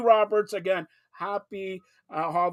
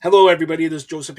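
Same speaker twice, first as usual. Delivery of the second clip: show host opener; 3 semitones lower, 6 dB louder, much faster than usual. Acted. Show opening greeting.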